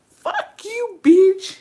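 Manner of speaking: high-pitched squeal, loud on the last word; hammed-up, squeaky comeback that bursts out; mock indignant retort. Retelling a retort to a rude crush, mock indignant.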